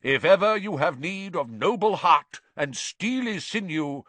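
high, loud, pompous knightly farewell; crying out. A narrator's reading. Knight takes leave of his companions, crying out. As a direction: declaim it grandly to comrades-in-arms. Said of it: "cried the knight".

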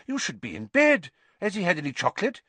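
anxious. A narrator's reading. Minister checking on boy with nurse, anxious. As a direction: fuss over the patient, a touch worried. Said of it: "he asked Madam Pumphrey anxiously".

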